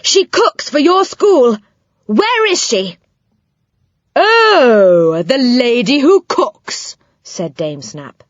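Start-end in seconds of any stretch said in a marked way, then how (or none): 0.0-3.0 put-on high, pressing boy's voice; a boy demanding answers, much higher; boy confronting the dame, high and urgent. A narrator's reading. Joe's urgent questions. 4.1-7.0 put-on high, slow, scornful old dame; old dame brushing Joe off, slow and high; dismissive dame. A narrator's reading. Dame Snap answers.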